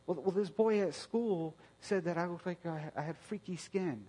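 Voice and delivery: a hint of a hurting child saying she hates herself; daughter's voice.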